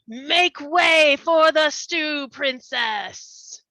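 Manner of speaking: grand, slow regal proclamation. Acted. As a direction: announce like a haughty princess. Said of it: "in her most regal voice".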